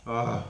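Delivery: a loud ugh after sneezing; sneeze groan. Real, not acted. Vlogger sneezing mid-review, a loud ugh.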